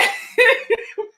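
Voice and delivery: real high burst of laughter; cracking up high; real laugh at the scene. Real, not acted. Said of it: "That shit was so funny to me".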